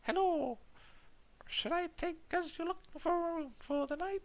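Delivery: high-pitched, lilting attempt at an Irish accent. Landlady greeting a traveller at her door, high Irish lilt. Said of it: "Her voice has a slight Irish lilt".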